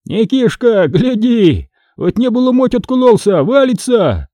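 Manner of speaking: high-pitched excited yell; crying out. Acted. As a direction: shout for him to look up. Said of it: "Фома закричал". (крик, высоко и возбуждённо)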